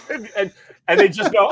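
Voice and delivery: normal talking voice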